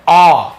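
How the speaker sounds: teacher modelling the aw in "आ"; vowel sound demo. Shown as a demonstration. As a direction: sound out "आ" as a rounded aw, drawn out. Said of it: "तीन letter वाले में क्या निकल रहा है".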